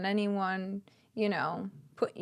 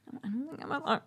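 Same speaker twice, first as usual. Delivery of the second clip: hushed, much softer mimicry; imitated whisper-yell; 3 semitones higher, 9 dB quieter, much faster than usual. Imitating a friend who won't talk, whisper-yelling. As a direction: do her urgent whisper under the breath. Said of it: "She, like, whispers and yells".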